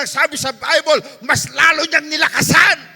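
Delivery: loud, fervent, sing-song preaching; preaching at full force. Acted. Pastor urging the church to keep praying, fervent shouted preaching. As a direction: drive it home to the congregation.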